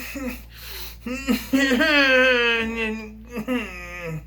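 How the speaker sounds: long amused laugh; a real burst of laughter. Real, not acted. Laughing after sarcastic yeah.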